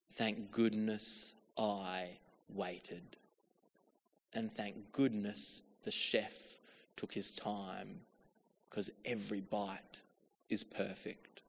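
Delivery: soft, slow, grateful; quiet relief. Retold or imitated. Diner finally tasting the food, soft slow relief. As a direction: savour each word, relieved. Relief after the long wait.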